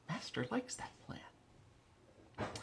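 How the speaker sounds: slow, hushed, high creature whisper; creepy minion whisper. Pretend. Praising the master's plan, a slow creepy whisper. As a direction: breathe it out slowly like a fawning creature.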